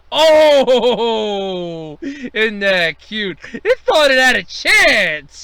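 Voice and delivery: loud, slow, sneering pity; mocking condescension. Boss mocking year 2020, loud and slow sneer. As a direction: taunt it like a pet.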